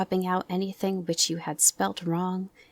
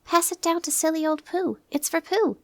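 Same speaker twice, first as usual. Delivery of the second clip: excited; 11 semitones higher, 3 dB louder than usual. Acted. Excited Christopher Robin.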